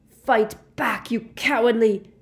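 high, loud, furious yell, cut off; screamed insult. A narrator's reading. Harry calling Snape a coward, screaming. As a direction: scream it until interrupted. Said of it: "Harry screamed at him".

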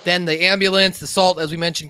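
ordinary talk, nothing marked

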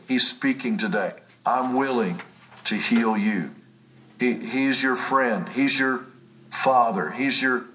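ordinary talk, nothing marked

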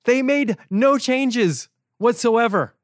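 sarcastic. Real, not acted. Speaker mocking the advisor's inaction, slow and sarcastic. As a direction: let the sarcasm drip, slow and pointed. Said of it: "from the sarcasm in my voice".

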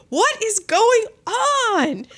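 high, slow, drawn-out disbelief; baffled. Retold or imitated. Recounting reaction to her weird face, baffled. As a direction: stretch it out in comic bewilderment.

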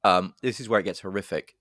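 usual speaking voice

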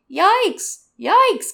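very high, loud bird cry; comic raven shriek; raven squawk. Acted. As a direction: squawk the word like an alarmed raven.

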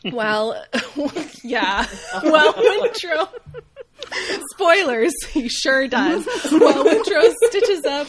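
high, loud words bursting through laughter; laughing. Real, not acted. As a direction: push on while cracking up. Cracking up while recapping.

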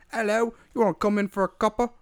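high Cockney worm voice; chirpy Cockney offering tea. Retold or imitated. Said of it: "a weird little Cockney worm".

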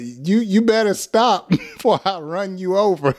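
laughing mock road rage. Shown as a demonstration. Teacher acting a road-rage driver through laughter. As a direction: yell from the car window while laughing. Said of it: "roll down the window".

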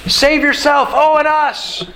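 high, sneering jeer at a dying man; mocking taunt. Criminal on the cross jeering at Jesus, high mocking taunt. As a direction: taunt him, pitched high and scornful. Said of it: "as he's mocking them".

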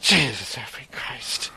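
whispered, slow muttered oath; under his breath. Shown as a demonstration. Traveler curses under his breath at the crowd, whispering. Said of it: "it was under my breath like that".

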